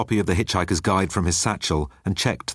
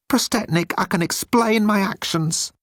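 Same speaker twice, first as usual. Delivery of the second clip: high, timid alien voice; high-pitched nervous alien; Moan's voice; 14 semitones higher than usual. Acted. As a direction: play Moan small and pleading.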